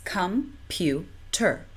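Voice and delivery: com-pu-ter said slowly, each syllable separate; syllables split apart. Shown as a demonstration. Teacher splitting a word for learners, slow syllables. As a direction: break com-pu-ter into three even beats. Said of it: "break it into three parts".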